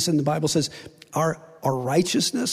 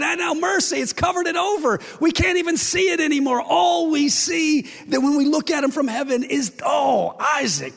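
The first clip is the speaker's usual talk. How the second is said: God beaming with pride over Isaac; joyful God voice; 7 semitones higher than usual. Acted. God answering Ramon about Isaac.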